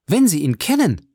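high, eager exclamation; eager cry. A narrator's reading. Julie begs the count about the man he knows, crying out. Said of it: "rief Julie".

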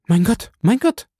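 high, frightened cry; terrified. A narrator's reading. Valentin cries out as midnight strikes, terrified. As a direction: gasp to God in terror.

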